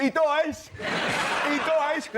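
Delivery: high, loud yelling of the spoiler; drunken shout. Retold or imitated. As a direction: bellow it like a drunk passing by.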